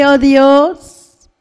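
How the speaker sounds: normal talking voice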